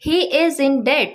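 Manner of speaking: slow, clear example line; slow example sentence. Shown as a demonstration. Teacher reading the example to students, slow and clear. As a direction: read each word slowly for learners. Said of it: "जैसे".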